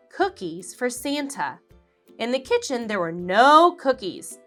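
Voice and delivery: ordinary delivery, nothing marked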